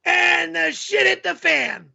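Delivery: put-on yelling voice, pitched well up; yelling character voice. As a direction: tell it in a high, yelled character voice. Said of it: "the guy yells all the time".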